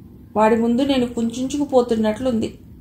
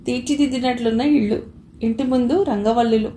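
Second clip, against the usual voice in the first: usual speaking voice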